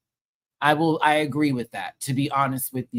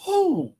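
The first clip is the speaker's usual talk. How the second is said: squealed whoo, way up high; high excited whoo. Real, not acted. Urging the contestant to fight back, a high whoo.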